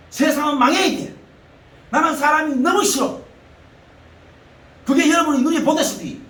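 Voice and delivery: fiery preaching. Acted. Preacher declaring to the congregation, fiery preaching.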